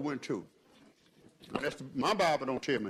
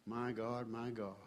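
much softer, lower murmured exclamation; quiet, lower aside to God; hushed; 6 semitones lower, 13 dB quieter, slower than usual. Real, not acted. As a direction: let it fall almost silent.

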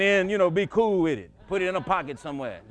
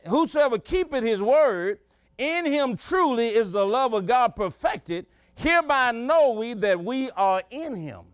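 slow, raised reading of a Bible verse; preacher intoning a verse to the congregation; scripture reading; 4 semitones higher, slower than usual. Acted. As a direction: read it out slow and solemn, lifting the pitch. Preacher reads scripture aloud.

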